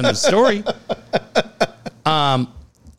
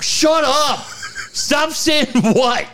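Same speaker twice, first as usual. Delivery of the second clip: retold angry outburst, pitched way up; mad yelling; 12 semitones higher, much slower than usual. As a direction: mimic someone snapping in high-pitched exasperation. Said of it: "You yelled at me like a fucking".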